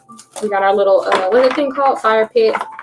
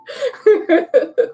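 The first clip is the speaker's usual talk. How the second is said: high laugh; 11 semitones higher than usual. Real, not acted. Laughing at viewer's comment.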